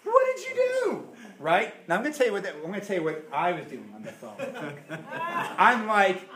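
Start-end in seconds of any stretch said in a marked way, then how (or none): 0.0-1.1 pitched way up in disbelief; stunned husband asking his wife; shocked question. Retold or imitated. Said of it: "I said". 4.4-5.5 laugh. Real, not acted. Laughing at own story.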